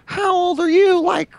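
high-pitched, sneering jeer; mocking online gamer. Gamers picking on a young player, mocking high voice. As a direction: imitate a bully taunting a kid. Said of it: "they'd be like".

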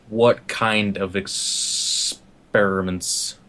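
very slow, low, menacing; creepy menacing repeat, very slow; creepy again. Acted. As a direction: creep it out word by word. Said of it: "hands on his shoulders".

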